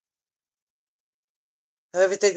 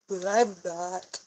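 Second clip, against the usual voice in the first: quiet, slow, holding back tears; tearful; 7 dB quieter, slower than usual. Real, not acted. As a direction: let the voice almost break. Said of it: "I'm baby crying".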